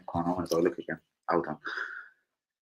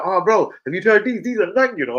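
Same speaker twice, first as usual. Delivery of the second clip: shop worker voice; 9 semitones higher, 4 dB louder than usual. Retold or imitated. Shopkeeper voiced in a story.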